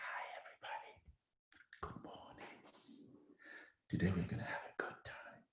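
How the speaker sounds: an odd whispered hello; opening the talk in a weird whisper; whispered greeting. Shown as a demonstration. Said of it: "I started like".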